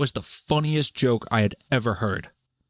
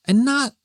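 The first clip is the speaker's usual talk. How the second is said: NOT jumps far higher and louder; sudden emphatic burst; 5 dB louder than usual. Real, not acted. Host correcting himself about the hearing, sudden loud high burst on not. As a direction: fling the word up in outrage.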